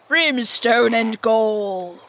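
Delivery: slow mimicked pirate oath; Hook. A narrator's reading. Peter retorts in the captain's voice, slow oath. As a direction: retort slowly as the blustering captain. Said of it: "answered in Hook's voice".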